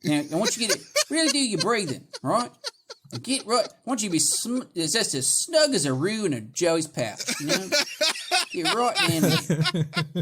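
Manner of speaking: lilting, hammed-up Australian instruction; broad Australian guide barely holding back laughs; Australian meditation guide. Guiding listeners through breathing, jokey broad Australian accent. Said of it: "you can't listen to in a guided meditation".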